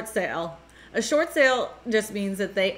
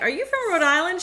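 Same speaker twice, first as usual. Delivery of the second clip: high-pitched questioning quote; raised-pitch retold question; retold eager question; 9 semitones higher than usual. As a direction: ask it high, as if recognizing someone. Retold surprised question to caller.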